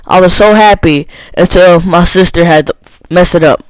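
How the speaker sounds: plain reading voice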